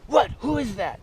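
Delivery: high, startled who-is-that; imitating a man woken at night; startled sleeper. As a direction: woken suddenly, a bit alarmed. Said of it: "and hear this".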